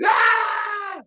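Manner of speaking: high wordless mock scream of a kid; child's scream. Preacher imitating a screaming kid, high mock scream. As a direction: shriek like a child with no reason. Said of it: "You screaming".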